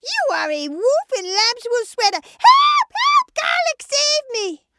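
high shriek building to screams; cries for help. Acted. Sheep unmasks the disguised wolf, cries for help. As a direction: build from loud accusation to screaming for rescue. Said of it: "Garlic heard the cries".

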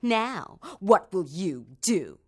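slow, cold, mocking stepmother; taunting stepmother. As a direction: lean on each word, daring her to answer. Cruel stepmother taunting the girl.

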